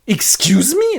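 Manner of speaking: high, loud incredulous question; shocked disbelief. Acted. Buyer hears the price, high incredulous question. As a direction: balk at it in squeaky surprise.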